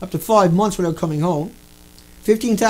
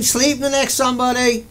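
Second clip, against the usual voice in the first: ordinary talk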